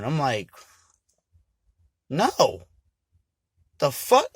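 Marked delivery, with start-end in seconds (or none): 2.1-4.3 loud, much higher, slowing on the curse; loud and high, outraged anger; furious outburst. Retold or imitated. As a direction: explode at him in outrage. Furious refusal of trade.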